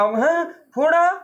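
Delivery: high, alarmed gasping question; a scared mother hearing "फोड़ा"; frightened mother. Acted. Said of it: "माँ को डर लगा". (डरी हुई माँ का ऊँचा सवाल)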